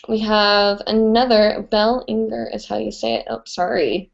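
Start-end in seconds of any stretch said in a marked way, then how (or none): none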